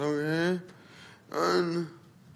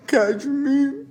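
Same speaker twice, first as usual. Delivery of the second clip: crying; 9 semitones higher, 4 dB louder than usual. Real, not acted.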